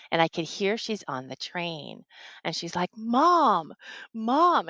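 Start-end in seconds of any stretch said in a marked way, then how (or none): 3.0-4.7 raised, louder mock-panicked cry; panicked crying daughter. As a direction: imitate a panicked daughter calling out. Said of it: "she's panicked ... she's crying".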